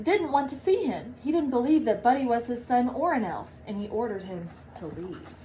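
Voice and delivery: ordinary delivery, nothing marked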